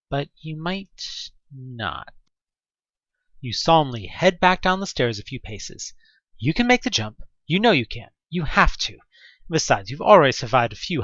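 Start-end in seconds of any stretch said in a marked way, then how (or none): none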